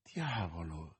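low, hushed musing; lost in thought. A narrator's reading. Older friend taking in the news, pensive. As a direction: murmur it to yourself, pondering. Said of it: "disse Athos sopra pensiero".

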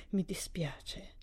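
slow, soft and half-whispered; slightly choked voice. A narrator's reading. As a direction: choke it back, barely voicing the words. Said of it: "con la voce un po' soffocata". (con voce un po' soffocata, lenta e sommessa)

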